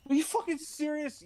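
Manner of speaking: high, incredulous whine. Acted. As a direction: whine it in disbelief. Moses protests to God.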